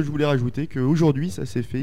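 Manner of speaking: ordinary delivery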